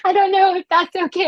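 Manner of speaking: high-pitched laugh carrying into the words. Real, not acted. Guest teasing host with a pet nickname, laughing.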